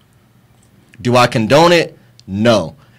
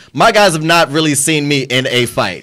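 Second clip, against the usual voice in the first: normal talking voice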